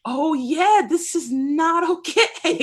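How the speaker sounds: the truth landing hard, slowly; slow teary laugh on this is not okay; tearful realization. Retold or imitated. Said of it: "hits you like".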